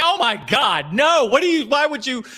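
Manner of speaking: high, flustered imitation of mom; mortified mom. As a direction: splutter it like an embarrassed mother. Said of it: "And she was like".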